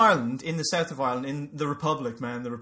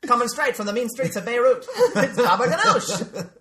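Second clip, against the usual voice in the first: raised, louder showman's intro with laughter; Baba Ganoush lounge singer; 6 semitones higher, 6 dB louder than usual. Acted. Lounge act introduces himself from Beirut, hammed-up. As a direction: announce yourself like a cheesy cabaret headliner. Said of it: "lounge singer".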